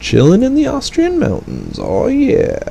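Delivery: high silly voice. Pretend. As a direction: squeak it out like a happy cartoon. Playful high voice about mountains.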